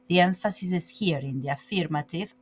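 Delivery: normal talking voice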